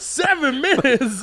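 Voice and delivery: high, drawn-out mock wail; exasperated yell. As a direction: stretch it out in high-pitched exasperation. Acted exasperated retelling of Uber meltdown.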